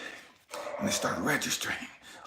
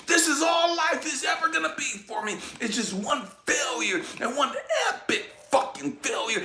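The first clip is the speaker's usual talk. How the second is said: despairing outburst; 7 semitones higher than usual. Acted. Voices the defeated self-talk of listeners.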